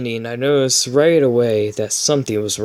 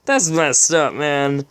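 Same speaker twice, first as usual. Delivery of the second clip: ordinary delivery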